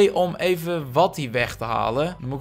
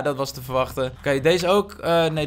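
ordinary talk

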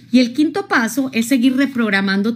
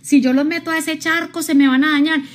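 retold yelling; 6 semitones higher than usual. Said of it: "la mujer solo le gritaba".

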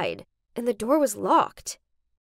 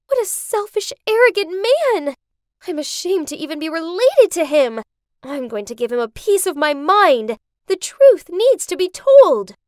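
indignant outburst; 5 semitones higher, 7 dB louder than usual. Acted. Tracy raging about her father.

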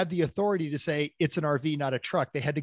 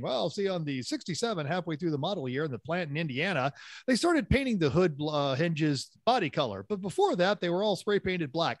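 Steve Magnete; 3 semitones higher than usual. Retold or imitated. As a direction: imitate an excited know-it-all reciting obscure car details. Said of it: "That was a spot on impersonation".

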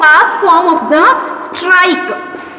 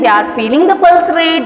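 ordinary talk

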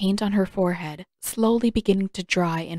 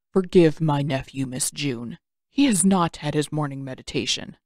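put-on lower, unhurried wise old uncle voice; calm old uncle; 4 semitones lower than usual. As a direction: speak as a patient old mentor.

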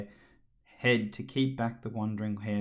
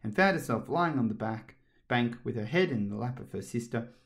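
usual speaking voice

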